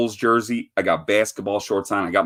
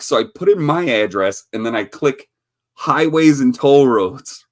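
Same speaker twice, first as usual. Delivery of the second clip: ordinary talk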